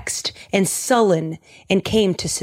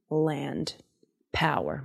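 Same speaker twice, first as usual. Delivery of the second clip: normal talking voice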